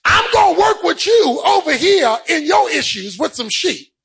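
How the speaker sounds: high, insistent sermon voice; impassioned preaching. Real, not acted. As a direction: drive each phrase home to the congregation. Preaches, voicing God to Moses.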